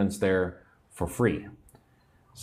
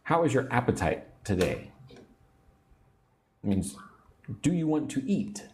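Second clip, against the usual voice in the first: normal talking voice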